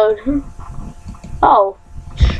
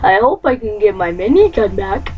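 ordinary delivery

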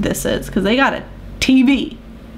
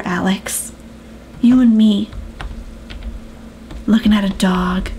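normal talking voice

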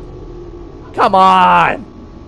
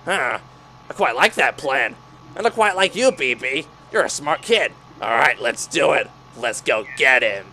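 low, soft scheming voice; scheming villain; 5 semitones lower, 7 dB quieter than usual. Acted. Villain agreeing to B B's plan, low and soft. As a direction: purr the plan, conspiratorial.

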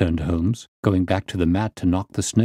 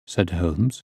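ordinary delivery

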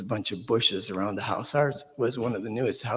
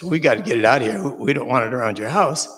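louder, faster urgent call; 7 dB louder than usual. Retold or imitated. Urging everyone to get the snake away from the house, loud and fast. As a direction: hurry them into action.

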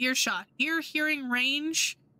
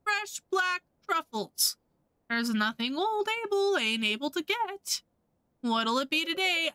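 drawn-out, higher-pitched trader's call; stretched vendor patter, easing into sales talk; merchant hawking wares; 4 semitones higher, slower than usual. Acted. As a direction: call out the stock like a market seller. Plays shop merchant in game.

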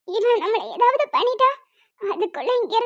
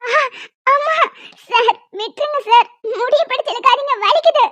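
crying out in pain; 3 dB louder than usual. Acted. Girl begs lender while her hair is pulled, crying out in pain. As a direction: yelp it as if hair is yanked.

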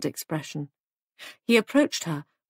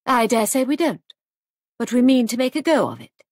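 higher, louder defiance; belligerent; 6 semitones higher, 5 dB louder than usual. A narrator's reading. Hostess defending her guest house to a doubter, belligerent. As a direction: stand up for yourself firmly. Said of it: "chin went up belligerently".

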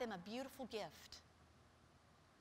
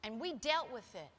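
ordinary talk

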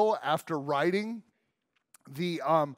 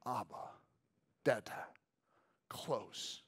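much softer, lower hush; hushed intimate; 5 semitones lower, 12 dB quieter, slower than usual. Acted. Preacher showing intimacy of Abba, hushed. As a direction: speak it close and tender.

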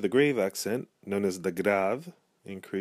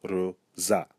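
"Rosa" split in two, RO... ZA, weight on za; "Rosa" said syllable by syllable, landing on ZA; stress on final syllable. Shown as a demonstration. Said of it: "has the emphasis".

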